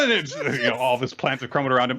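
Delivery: usual speaking voice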